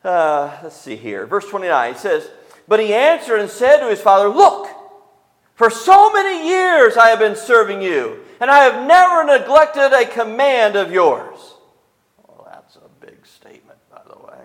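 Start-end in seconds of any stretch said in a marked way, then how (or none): 2.7-11.5 loud, much higher protest of a wronged son; indignant son. Acted. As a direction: hammer out the grievance to the father. Elder son complains to father.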